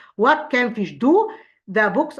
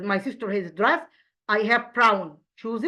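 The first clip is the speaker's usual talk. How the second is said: ordinary talk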